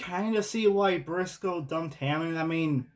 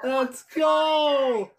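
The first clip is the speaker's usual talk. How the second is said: excited shout; 7 semitones higher, 3 dB louder than usual. Real, not acted. Celebrating the race win.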